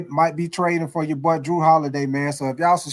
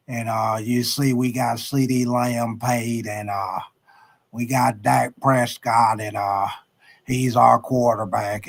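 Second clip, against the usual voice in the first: lower, slow, drawn-out Jerry Jones voice with long uh pauses; a Texan team owner choosing each word slowly; Jerry Jones impression; 4 semitones lower than usual. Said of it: "my Jerry Jones impersonation".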